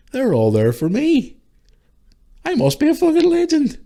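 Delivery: high, loud, cocky voice of the friend laughing down from above; mock boastful friend. Friend looking down on his funeral, high cocky bragging. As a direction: ham up the departed joker bragging about his turnout. Voicing the late friend joking.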